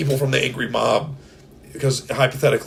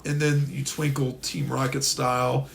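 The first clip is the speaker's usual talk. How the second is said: ordinary talk, nothing marked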